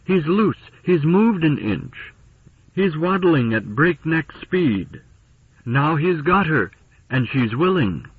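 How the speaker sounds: high-pitched, excited running commentary; excited cry. A narrator's reading. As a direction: cry out with lively excitement, as narrated dialogue. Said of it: "She looked down again and cried".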